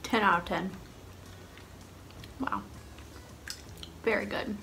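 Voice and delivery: talking with food in mouth; speaking between chews; chewing while talking. Real, not acted. Seen 1: Bit egg at -9, lips pressed and working between words, egg held.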